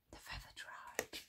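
hushed, breath-only whisper; gasped. A narrator's reading. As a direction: gasp it out under your breath. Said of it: "gasped Flint".

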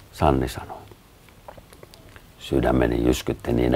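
plain reading voice

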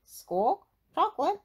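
high, loud squawking parrot; sharp parrot echo; copying parrot. A narrator's reading. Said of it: "Polly Parrot copied".

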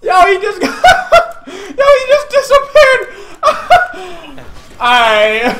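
high, loud crying laughter; laughing hysterically. Real, not acted. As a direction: wheeze the words out laughing.

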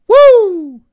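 high, loud crowd "wooo"; imitated "woo". Host mimics crowd noise for a fighter, loud whoop. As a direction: whoop like a fan in the audience.

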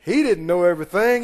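ordinary delivery

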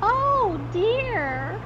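very high cry of dismay; old lady's cry. A narrator's reading. Old lady on the hockey stick, high dismayed cry. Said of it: "cried the old lady".

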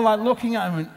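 usual speaking voice

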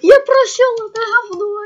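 high loud victory yell; triumphant shout. Real, not acted. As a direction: scream it in relief. Finally passes the level. (победный крик)

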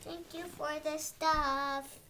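loud and drawn out; slow, loud thank you. Real, not acted. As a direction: call it out slowly. Child thanks grandparents to camera.

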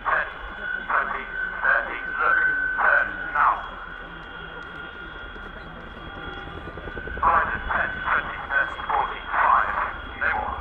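crew intercom over rotor noise; clipped radio countdown calls. Real, not acted.

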